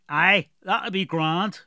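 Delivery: slow, thick southern fisherman's dialect; gruff dwarf with a heavy rural accent; strong dwarf accent. Acted. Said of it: "Galoric had a very strong accent".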